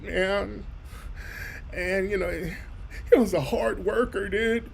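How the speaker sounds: soft laid-back surfer drawl; mock sincere condolences, softly; surfer dude sympathy. Mocking fake sympathy, surfer style.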